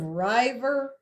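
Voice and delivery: river said as "RYE-ver"; river with a long i, like "driver" without the d; long-i misreading. Shown as a demonstration. Teacher asks if anyone has heard it, long-i river. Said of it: "if we coded it like this".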